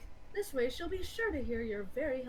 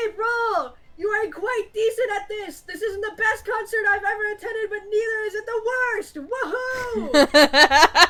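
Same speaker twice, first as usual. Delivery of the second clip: loud, high-pitched yelling of measured praise; mock-earnest concert heckle ending in a big whoop; shouted fan support; 8 semitones higher, 11 dB louder than usual. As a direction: holler it at the stage over the crowd.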